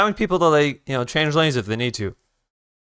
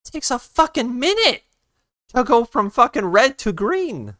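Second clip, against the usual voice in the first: exasperated rant; 9 semitones higher than usual. Real, not acted. Ranting about slow traffic lights.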